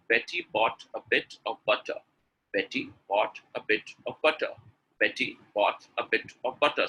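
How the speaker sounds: very slow reading. Shown as a demonstration. Teacher showing how to practise one line, very slowly. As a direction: read slowly with gaps between the words. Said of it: "Read one line very slowly".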